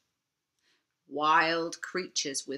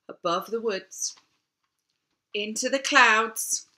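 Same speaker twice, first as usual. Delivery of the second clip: ordinary delivery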